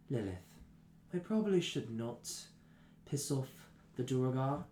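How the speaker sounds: lightly voiced, low and soft caution; measured and quiet, with pauses; familiar companion's warning. Companion cautioning Lilith about the Duergar, lightly acted restraint. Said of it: "you hear a extremely familiar voice".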